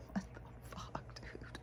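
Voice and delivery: hushed unvoiced what the fuck dude; whispered curse. She retells her shock at the hair, whispering. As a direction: whisper it in disbelief. Retold reaction to hair clump.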